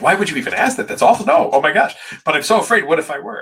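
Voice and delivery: high-pitched mock horror, then a frightened plea; horrified and scared. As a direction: play the shocked, scared group member. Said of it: "This look on their face, like".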